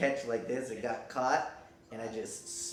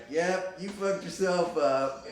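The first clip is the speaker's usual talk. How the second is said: higher, slower put-on voice of a blunt paramedic; mocking paramedic; 6 semitones higher, 4 dB louder, slower than usual. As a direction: drag out the verdict like a deadpan medic. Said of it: "So the paramedics come, like".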